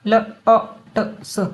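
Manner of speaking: lots split into l, o, t, s; each sound of lots said separately. Shown as a demonstration. Teacher sounding out lots for learners, segmented. Word sounded out, then blended.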